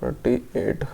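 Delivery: Forty eight whispered under the breath; forty eight breathed softly as a private note; whispered aside. Real, not acted. Repeats the number under breath.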